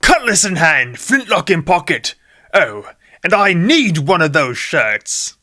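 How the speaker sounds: D J joins in with a hammed-up pirate brogue; pirate accent. D J catches the mood and goes pirate, lightly put-on accent. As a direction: lean into a mock pirate swagger. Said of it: "when he starts with the accent".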